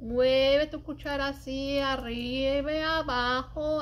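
usual speaking voice

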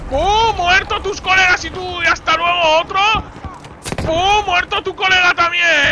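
high, loud explosive taunt; gloating shout. Pretend. Player taunts enemy over dead mates, gloating shout. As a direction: fire each boom out and crow.

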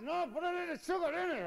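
high, strained patient's voice; squeaky punchline. Acted. Patient correcting the doctor, in a high strained squeak. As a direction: deliver it in a tight high squeak.

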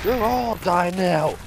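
slow high gloating yell; drawn-out gloat. Real, not acted. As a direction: stretch every word out, gloating. Gloating at enemies.

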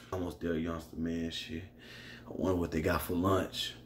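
put-on low, soft, drowsy old-timer voice; sleepy old man. Narrator voicing the old cellmate, drowsy and low. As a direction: mumble it like a half-asleep old man.

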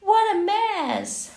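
high, loud and slow big reveal; drawn-out exclaiming of the punchline; excited reveal. A narrator's reading. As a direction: announce it like a grand surprise.

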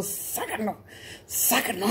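soft hissing snake. Acted. Storyteller as the snake remembering its line, soft hiss. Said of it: "el pinche víbora dijo".

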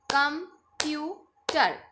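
Computer in three slow, separated beats; three-beat syllables. Shown as a demonstration. Teacher clapping out syllables, computer split in three. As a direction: pause after each syllable of computer. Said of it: "three times clap करेंगे".

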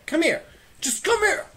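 high loud shout; yelling. Real, not acted. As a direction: call out in frustration. Yelling at enemy.

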